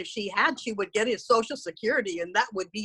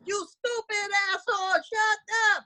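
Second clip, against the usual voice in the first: mock yell; 10 semitones higher than usual. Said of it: "yelling at the kid".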